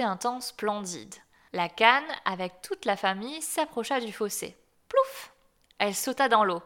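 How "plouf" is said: high, loud splashing plouf; a high, loud cartoon splash; splash sound. A narrator's reading. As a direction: make the splash pop up high. Splash as duck jumps in.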